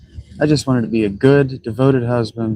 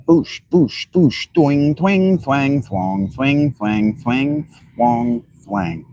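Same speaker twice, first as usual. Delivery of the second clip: imitated twanging noises; 3 semitones higher, much slower than usual. Mouth sound effects while playing.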